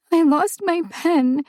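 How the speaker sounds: put-on high, slow small child's voice; a sad little girl confessing; child still teary from crying. A narrator's reading. Tearful child speaks up.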